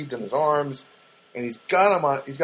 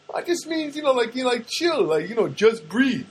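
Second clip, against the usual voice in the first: acted neighbor; 10 semitones higher than usual. Acts out neighbor explaining his tattoo.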